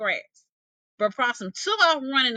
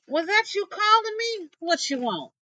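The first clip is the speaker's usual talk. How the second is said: high, raised call down into a hole; 9 semitones higher than usual. Acted. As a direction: call down to the snake below. Possum calls down the hole.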